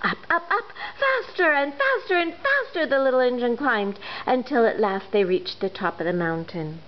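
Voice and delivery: usual speaking voice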